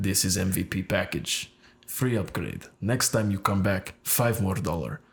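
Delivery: mock Russian salesperson's accent; thick Russian accent. Hairdresser selling the client an upgrade, thick Russian accent. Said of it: "very thick Russian accent".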